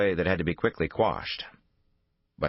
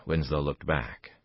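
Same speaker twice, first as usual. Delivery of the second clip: usual speaking voice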